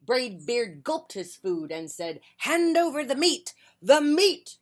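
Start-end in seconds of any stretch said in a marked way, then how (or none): 2.4-3.5 loud, much higher gruff demand; pirate demand. Acted. Captain demanding food. 3.8-4.6 loud, much higher cry; the crew shouting for meat. Acted. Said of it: "we all shouted".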